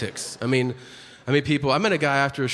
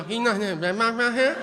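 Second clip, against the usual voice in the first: put-on higher mumble in a thick Cajun accent; garbled Cajun accent; 7 semitones higher than usual. Comic mocking a Cajun man asking about a Red Box, garbled Cajun accent. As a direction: slur it like a Cajun stranger. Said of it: "He go".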